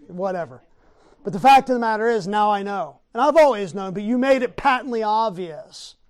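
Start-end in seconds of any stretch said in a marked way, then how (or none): 1.2-6.0 loud, fast fiery preaching; preaching at full force. Acted. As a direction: thunder it from the pulpit. Preacher builds to a shout.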